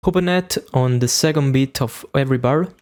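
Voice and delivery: usual speaking voice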